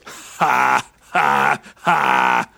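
low, deliberate robotic ha ha ha; a hint of a deep robot chuckle; slow robot laugh. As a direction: settle back and laugh like a machine. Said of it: "the robot, settles back and goes".

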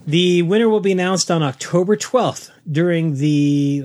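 ordinary talk, nothing marked

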